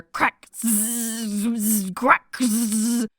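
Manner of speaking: mouth-made crackle and buzz; lightning sound effects. Retold or imitated. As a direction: imitate lightning zaps.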